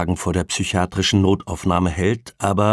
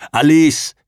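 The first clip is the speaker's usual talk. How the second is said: loud, raised cry of a name; calling out; 8 semitones higher, 10 dB louder than usual. A narrator's reading. Man fallen from hospital bed, calling out for Alice. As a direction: call the name out urgently. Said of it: "rief er".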